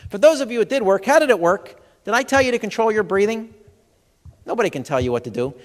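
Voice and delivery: ordinary talk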